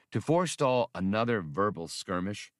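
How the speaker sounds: ordinary delivery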